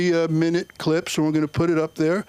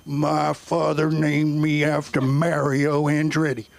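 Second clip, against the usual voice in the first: slow, lightly drawn-out Southern armorer; a hint of a slow Southern drawl; a light Southern accent, taken slowly; Southern boy accent; 2 semitones lower, slower than usual. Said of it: "he would go".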